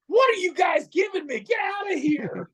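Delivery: furious boss yelling at the pitch; angry shout. Retold or imitated. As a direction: throw them out of the office with a yell.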